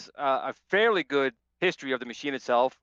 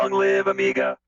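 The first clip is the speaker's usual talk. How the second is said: much lower, pitched-down robot voice; Amiga voice; 5 dB louder than usual. Pretend. Host switches to a mock computer voice, pitched far down. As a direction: speak as if through a pitch-down modulator. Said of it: "I'll put on my Amiga voice".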